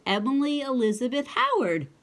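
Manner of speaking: plain reading voice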